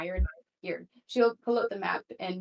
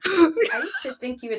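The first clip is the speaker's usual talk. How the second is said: half-shouted through laughter; laughing; 8 semitones higher, 8 dB louder than usual. Real, not acted. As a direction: laugh while blurting it out. Player laughing at poetry reveal.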